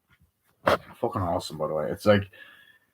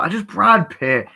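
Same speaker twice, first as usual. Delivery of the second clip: ordinary talk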